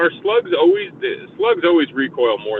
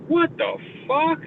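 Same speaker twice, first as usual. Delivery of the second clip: high, slow "what the fuck"; hammed-up astonishment, pitched way up; stunned disbelief; 14 semitones higher, 3 dB quieter, slower than usual. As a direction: drag it out in shocked disbelief. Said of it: "I'm like".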